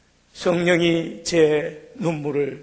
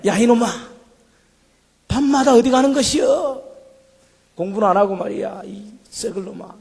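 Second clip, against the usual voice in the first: mock scolding, higher and louder; hammed-up Jeolla country mom scolding her son; a hint of a shrill angry mother; 7 semitones higher, 3 dB louder than usual. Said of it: "욕을 하더라고요".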